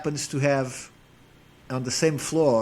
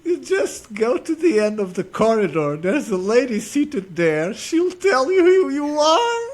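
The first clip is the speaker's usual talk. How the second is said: calm reply through laughter; 9 semitones higher, 4 dB louder than usual. Retold or imitated. Receptionist calmly putting the arrogant academic in his place, laughing. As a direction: try to stay calm but keep breaking up laughing. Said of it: "she very calmly turns to him".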